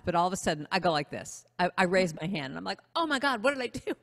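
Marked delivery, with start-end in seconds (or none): 2.9-3.9 high-pitched, hammed-up dismay; mock panic. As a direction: act out sudden regret in a high voice. Retells panic at her raised hand.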